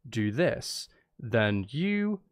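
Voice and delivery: normal talking voice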